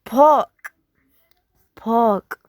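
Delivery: "pork" with no r, like pahk; British park. Shown as a demonstration. Teacher contrasting accents, British silent r. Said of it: "In British is".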